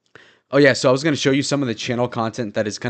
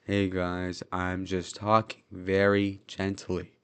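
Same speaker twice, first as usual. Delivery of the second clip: slow, soft, limp mock voice, much softer; slow gentle loser voice; 4 semitones lower, 8 dB quieter, much slower than usual. Shown as a demonstration. Mocking a slow-talking streamer, slow and soft mock voice. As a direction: drag it out gently like a lazy loser. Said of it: "Imagine a loser talking all slow".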